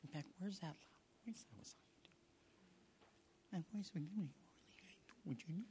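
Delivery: faint breathy whisper; barely-there whisper. Acted. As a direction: strain to be heard at all. Mimics a faint God voice.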